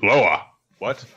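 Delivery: mock Phil. Voicing Phil replying to Andrew.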